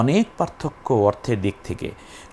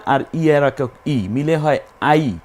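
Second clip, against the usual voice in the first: ordinary delivery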